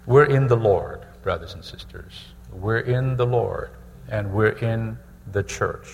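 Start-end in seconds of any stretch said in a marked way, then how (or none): none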